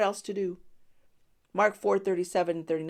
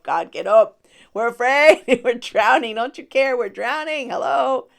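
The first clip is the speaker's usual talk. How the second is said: loud, high panicked pleading; freaking out; 6 semitones higher, 12 dB louder, slower than usual. Retold or imitated. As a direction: shout like a terrified sailor. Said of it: "they were freaking out".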